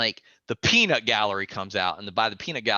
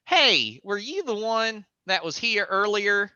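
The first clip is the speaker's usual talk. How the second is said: high, friendly asking voice; lightly voiced woman, sweet and curious; mom asking; 9 semitones higher, 3 dB louder than usual. Mom on the porch asking a stranger, high and sweet. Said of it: "she goes".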